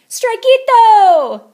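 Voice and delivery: high, loud, slow drawn-out cheer; shout. A narrator's reading. Pachitos cheering the crash, slow high shout. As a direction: stretch the cheer out long. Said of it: "shouted the Pachitos".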